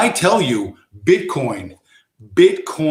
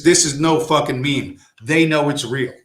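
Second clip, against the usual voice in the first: usual speaking voice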